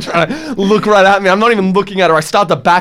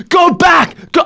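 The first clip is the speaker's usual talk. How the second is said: high, loud shouted command; barked order; 13 semitones higher, 5 dB louder, slower than usual. Retold or imitated. Angry man shouting at the trapped driver to reverse, barked. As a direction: snap the order at him.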